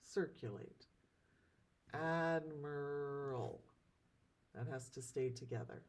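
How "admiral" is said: admiral drawn out very slowly by syllable; ad, mi, ral, each beat apart; syllables split. Shown as a demonstration. Teacher splits admiral for class, very slowly. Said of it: "how we would split the syllables".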